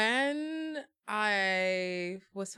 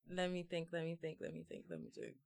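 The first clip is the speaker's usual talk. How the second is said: muttering to herself; 3 semitones lower, 9 dB quieter than usual. Real, not acted. Fading, thinking aloud to herself.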